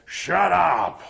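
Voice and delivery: yelled command. Acted. Goblin boss silences the bone snapper, short loud yell. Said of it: "And then he yells".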